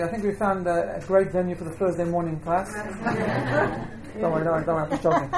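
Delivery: usual speaking voice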